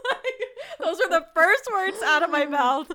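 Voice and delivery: talking through laughter; laughing. Real, not acted. Recalling her own reaction, laughing through it. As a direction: giggle through the words.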